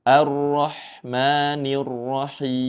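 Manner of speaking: slow melodic recitation; Quran recitation. Shown as a demonstration. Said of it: "membaca seperti ini".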